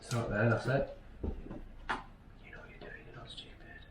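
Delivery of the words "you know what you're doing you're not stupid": barely audible whisper; very soft, whispered aside to a workmate; hushed aside. Real, not acted. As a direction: mutter it under your breath, almost no voice. Aside while the mate works.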